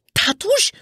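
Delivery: very high, questioning squeak; astonished puppet. A narrator's reading. Puppet hearing his lost father, astonished. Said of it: "zdziwił się bardzo pajacyk".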